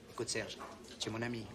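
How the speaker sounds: mimicking the debtor's sweet-talking voice to his creditor; honeyed voice. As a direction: coax him like a sly old friend. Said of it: "il commence à prendre sa voix de miel".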